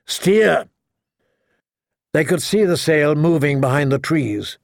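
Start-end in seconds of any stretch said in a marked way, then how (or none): 0.0-0.7 short, higher-pitched order; snapped. A narrator's reading. Jamie ordering his cousin, snapped. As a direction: snap out the one word. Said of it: "he snapped at his cousin".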